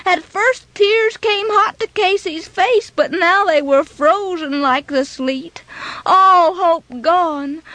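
plain reading voice

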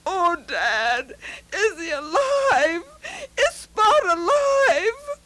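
rising, tearful, slow plea; boy crying to his father about the dog; pleading boy. A narrator's reading. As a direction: beg with fear in the voice. Pete begs about his dog.

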